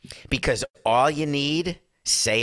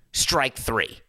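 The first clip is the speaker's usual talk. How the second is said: ordinary delivery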